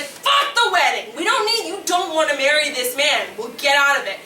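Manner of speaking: rally yourself like a rebellious inner voice; defiant inner voice. Retold or imitated. Inner voice dismissing the wedding, loud and defiant. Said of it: "the other voice is like".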